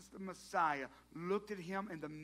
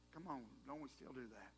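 ordinary delivery

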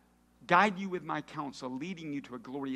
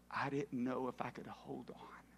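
soft breathless whisper; worker's quiet inner thought, whispered; 4 semitones lower, 7 dB quieter than usual. Retold or imitated. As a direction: whisper it like a private confession.

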